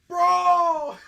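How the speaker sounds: loud, laughing shout; excited outburst. Real, not acted. Viewer erupting at Batman's line, excited shout.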